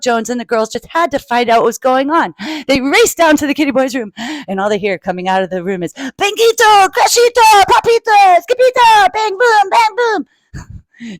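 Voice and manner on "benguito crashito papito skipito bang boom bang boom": high, loud battle-game shouts; excited playroom chant; wild play noises. Acted. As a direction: kid crashing about in pretend fight. Noises heard from the closet.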